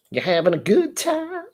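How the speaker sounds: playfully hammed-up, high-pitched query; put-on odd voice. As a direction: sing it out in a high, silly voice. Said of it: "why I said it like that".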